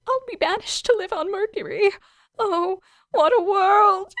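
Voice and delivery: high, loud wailing lament; melodramatic despair. Acted. Alien saleswoman to student, melodramatic wailing. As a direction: ham up the tragedy.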